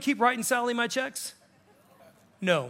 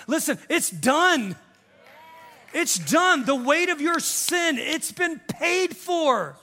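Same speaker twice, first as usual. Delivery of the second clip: slow ringing preacher's declaration; full force preaching; 4 semitones higher, slower than usual. Acted. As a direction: lift the burden off them.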